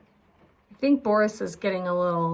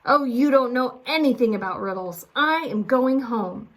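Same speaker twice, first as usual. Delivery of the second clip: louder, fast, annoyed; fed-up shout; 4 semitones higher, 6 dB louder than usual. A narrator's reading. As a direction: storm off in a huff. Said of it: "Boris shouted".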